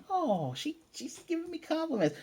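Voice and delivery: put-on very high, flattered little-girl squeal; high-pitched mock swoon; imitated dazzled gushing. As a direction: play a child melting at praise. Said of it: "gets all dazzly and sparkly".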